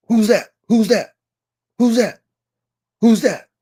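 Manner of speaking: high, loud, sharp yips in words; translated dog bark. Retold or imitated. Dog alerting owner at night, imitated bark. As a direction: bark it out in words, pitch falling each time. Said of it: "if I could translate what a bark is".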